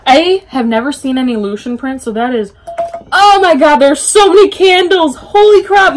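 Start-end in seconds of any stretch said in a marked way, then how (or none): 3.1-6.0 high, loud burst of amazement; amazed excitement. Real, not acted. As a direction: exclaim it high and loud, thrilled. Amazed at many candles.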